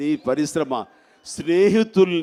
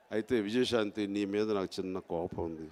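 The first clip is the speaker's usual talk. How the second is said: mock hushed complaint; 11 semitones lower, 13 dB quieter than usual.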